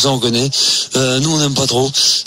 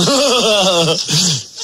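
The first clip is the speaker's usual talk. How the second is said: natural laugh; 4 semitones higher than usual. Shown as a demonstration. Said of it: "je ris naturellement".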